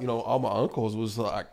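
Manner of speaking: ordinary delivery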